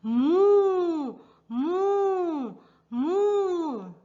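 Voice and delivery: long, high, drawn-out moo; cow moo. Acted. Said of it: "Cows says".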